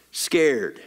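ordinary talk

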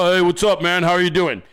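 lightly voiced deep bro; mock masculine voice. Mimicking the worker in makeup, casual masculine voice. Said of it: "with that grotesque fucking masculine voice".

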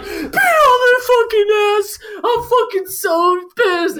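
high, strained yell egging on a fighter; re-enacted furious cheering. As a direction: relive yelling from the crowd, pitch shooting up.

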